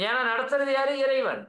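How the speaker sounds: normal talking voice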